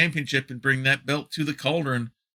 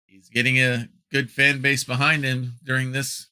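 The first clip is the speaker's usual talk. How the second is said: ordinary talk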